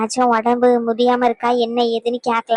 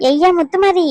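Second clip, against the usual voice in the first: loud call with raised pitch; mother calls son from outside, loud call; 4 semitones higher, 5 dB louder, slower than usual. Acted. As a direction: call your son from the doorway. Mother calling son by name.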